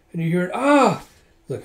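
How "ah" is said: sudden high, loud cry; startling mock scream from another room; acted scream.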